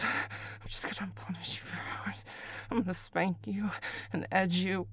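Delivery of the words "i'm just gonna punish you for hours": soft menacing whisper; whispered threat. Acted. Caller promising punishment later, soft whisper.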